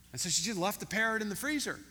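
ordinary talk

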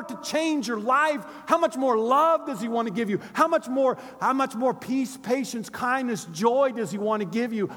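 impassioned preacher building on repetition; full-force preaching. Real, not acted. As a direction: pound out each repeated phrase to the congregation.

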